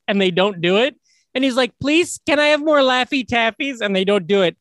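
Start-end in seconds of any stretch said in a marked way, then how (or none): 1.8-3.8 put-on higher-pitched pleading child's call; imitated kid calling up. Retelling a kid's second request up the chute, mock pleading call. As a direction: mimic a kid asking upward. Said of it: "just yells up".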